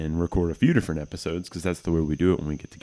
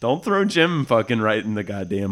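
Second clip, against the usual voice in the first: excited protest; 5 semitones higher, 4 dB louder than usual. Real, not acted. Joking protest.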